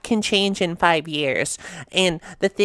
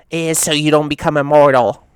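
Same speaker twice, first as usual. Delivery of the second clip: loud punchline; 8 dB louder than usual. Real, not acted. Punchline delivered with a push.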